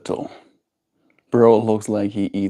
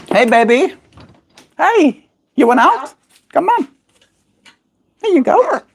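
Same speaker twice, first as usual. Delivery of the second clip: cooing much higher at the pet. Real, not acted. Owner coaxing her bird out of the cage, high cooing.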